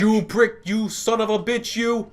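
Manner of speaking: high, loud furious shopkeeper; angry shopkeeper yell. Acted. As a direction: yell at the thief. Voicing shopkeeper.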